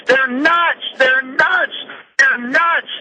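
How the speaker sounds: "nuts" hit high each time; emphatic repetition. Real, not acted. Radio host denouncing the police, emphatic and high. Host hammering his point home.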